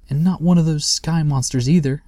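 high, worried little voice of Sniff; a small creature fretting about monsters; anxious. A narrator's reading. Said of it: "asked Sniff anxiously".

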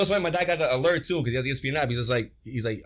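normal talking voice

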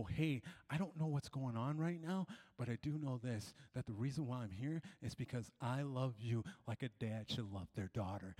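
soft, low, tender offer; re-enacted fatherly comfort, hushed; gentle reassurance. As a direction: reassure a crying teenager quietly. Comforting a crying girl.

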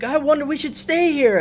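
put-on campy falsetto girlfriend; mock high-pitched wish; female impersonation. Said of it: "so she's like".

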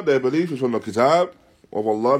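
usual speaking voice

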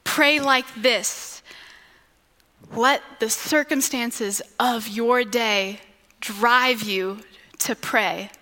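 slow, measured prayer-guide delivery; reflective refrain. Acted. Preacher guiding listeners into prayer, slow reflective refrain. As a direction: lead them gently, leaving space between phrases.

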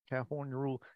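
put-on soft, tentative hillbilly accent. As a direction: try a hillbilly Southern accent quietly. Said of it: "like, hillbilly".

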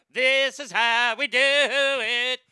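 slow, loud wrestling promo roar; wrestling promo shout. Acted. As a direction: bellow it like a pro wrestler hyping a match. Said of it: "sounds like an old school wrestling promo".

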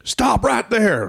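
very high, slow yelled halt; shouted command. Retold or imitated. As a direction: bark a stop from the dark. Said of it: "all of a sudden I hear".

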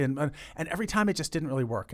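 ordinary talk, nothing marked

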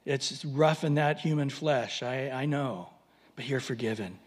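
lightly voiced, kindly consoling Jesus; gentle Jesus. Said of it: "And Jesus says".

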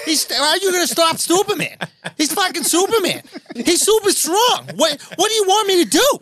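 Boston accent, hammed-up helpless whine; mock Boston whine.

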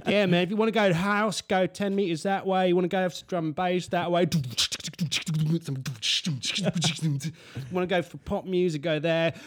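mock bar promoter. Drunk beach bar promoter voiced.

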